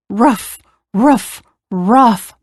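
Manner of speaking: short mild woofs; barking dog. A narrator's reading. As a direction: lightly imitate a dog's bark. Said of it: "barked the dog".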